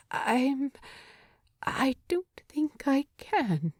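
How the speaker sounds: breathy near-whisper, voice failing; he can barely get the words out; strained whisper. Acted. Bilbo whispering to Thorin, too choked to speak.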